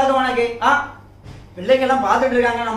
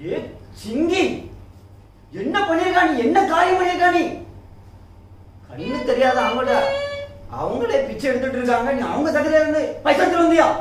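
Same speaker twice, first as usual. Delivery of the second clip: shocked scolding; 6 semitones higher, 3 dB quieter than usual. Acted. Uncle scolds Chinki for stealing.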